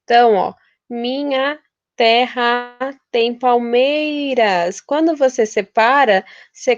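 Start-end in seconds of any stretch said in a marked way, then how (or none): 0.9-4.8 slow, syllable-by-syllable scansion; escandindo devagar. Shown as a demonstration. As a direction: read the verse out slowly, counting syllables. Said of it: "a contagem termina na sílaba mais forte". (lendo o verso devagar, sílaba por sílaba)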